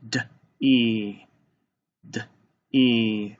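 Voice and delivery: "D, e" as a short d, pause, held ee; sounded out. Shown as a demonstration. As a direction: tap out "D, e" sound by sound. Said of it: "Let's tap this one out".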